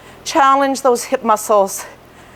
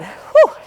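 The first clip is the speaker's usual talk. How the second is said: breathy whew; 12 semitones higher than usual. Real, not acted. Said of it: "I'm sweating".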